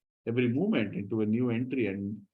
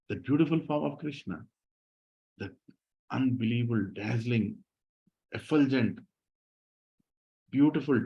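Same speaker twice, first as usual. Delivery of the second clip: ordinary talk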